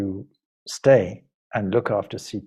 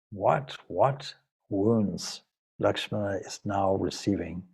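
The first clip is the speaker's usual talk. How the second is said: story narration; 3 semitones lower than usual. A narrator's reading. Story narration, slow and soft.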